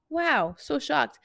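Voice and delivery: sarcastic mock surprise. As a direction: draw it out in self-mocking disbelief. Sarcastic aside on her own failure.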